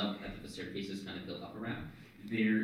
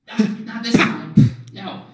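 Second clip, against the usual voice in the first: high, loud cry to stop him; urgent blurted protest; 13 semitones higher, 16 dB louder than usual. Acted. Player beating Leon to the orb, high urgent shout. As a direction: shout it in a scramble to get there first.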